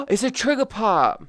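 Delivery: a gasp as it clicks; sudden realisation. Real, not acted. Spotting the trigger part, a surprised gasp.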